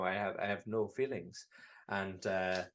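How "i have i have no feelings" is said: lightly voiced robot. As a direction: speak like a calm robot. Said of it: "you know".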